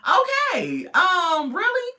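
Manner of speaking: high, loud disbelieving really; incredulous mom. Retold or imitated. Mom doubting Joy's money excuse, high and loud. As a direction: squeal out a shocked really. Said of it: "The mom was like".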